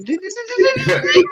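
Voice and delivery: very high, sung-out cartoon dings; cartoon ding noises. Telling his mate about a shocked friend, high cartoon dings. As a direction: imitate cartoon eyes popping, ding ding. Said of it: "His eyes went ding".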